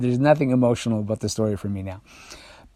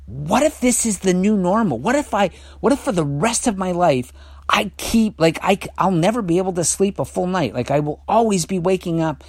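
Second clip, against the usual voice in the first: higher-pitched worried what-ifs; anxious thought; 7 semitones higher than usual. Retold or imitated. Recounting his sleepless night's panicked thought, anxious. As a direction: voice the dread climbing high, reliving it. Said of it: "I had this thought, and the thought was".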